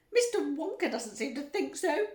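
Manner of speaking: high, loud indignant cry. A narrator's reading. Mother accusing the factory owner, crying out. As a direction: cry out in outrage. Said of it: "cried Missus Gloop".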